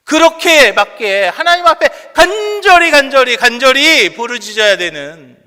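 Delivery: very loud, high pleading with stretched key words; impassioned preaching. Real, not acted. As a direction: cry out urgently to the congregation. Preaches at full force, pleading. (간절하게 부르짖는 설교)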